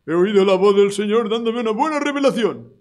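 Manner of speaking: mock the self-proclaimed prophet; mocking boaster. Preacher mocking those claiming a nice revelation. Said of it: "no me creo a ninguno que dice".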